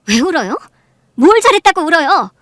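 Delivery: loud, climbing in pitch; angry scolding. Acted. Wife to the husband's crying mistress, angry.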